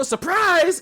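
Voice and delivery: high-pitched announcer "surprise"; high, mocking gleeful call; mock yell. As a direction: mimic an annoying commentator's shout. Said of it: "But they go".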